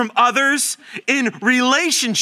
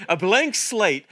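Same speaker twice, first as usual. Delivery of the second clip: usual speaking voice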